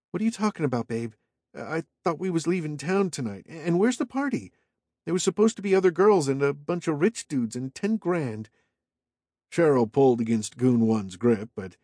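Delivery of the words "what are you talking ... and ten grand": put-on higher, whiny young woman; Cheryl's voice, confused. A narrator's reading. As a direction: protest high and puzzled.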